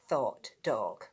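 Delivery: ordinary delivery, nothing marked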